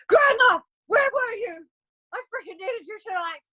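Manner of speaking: high, loud, hammed-up crying shout; mock outraged wail. Joking scolding of a no-show grandma, mock wail. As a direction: fake a betrayed tantrum. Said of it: "I was like".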